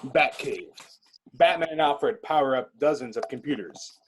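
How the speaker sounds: usual speaking voice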